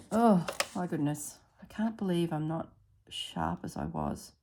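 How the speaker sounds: usual speaking voice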